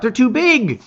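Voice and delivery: shout. A narrator's reading. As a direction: keep yelling over the noise. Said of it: "you shout".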